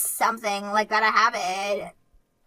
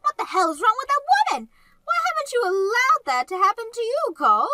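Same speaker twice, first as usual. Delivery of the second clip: high, indignant outburst; shocked anger, pitched way up; outraged; 11 semitones higher than usual. Acted. Mother outraged on son's behalf.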